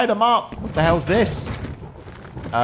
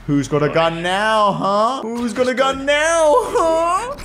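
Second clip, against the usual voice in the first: mock tough taunt; 12 semitones higher, slower than usual. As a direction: taunt rising higher on each huh. Taunting enemies with gun.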